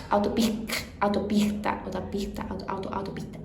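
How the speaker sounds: autopista with the s breathed like a soft R; Argentine s before consonant. Shown as a demonstration. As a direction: say autopista letting the s become a rasp. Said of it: "É como se fosse um R". (autopista com o S aspirado, quase um R)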